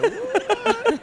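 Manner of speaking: laughing, high-pitched disbelief; baffled outburst. Acted. Guest reacting to surreal cider-fuelled talk, baffled squeal. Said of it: "I'm like".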